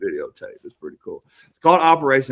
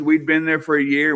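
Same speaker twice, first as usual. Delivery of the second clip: ordinary talk, nothing marked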